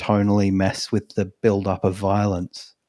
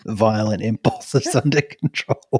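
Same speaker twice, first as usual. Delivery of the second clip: ordinary talk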